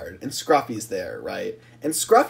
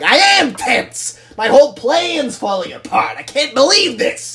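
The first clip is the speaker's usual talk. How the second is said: loud, high-pitched mock rant; enraged; 9 semitones higher, 6 dB louder than usual. Scruffy exploding at his worried fellow trucks, enraged. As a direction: erupt in hammed-up fury, stretching the first words. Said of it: "he's just enraged".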